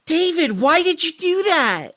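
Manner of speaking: high, loud accusing cry at David; indignant outcry. Acted. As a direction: demand an answer, voice jumping up. Player calls out to David in scene.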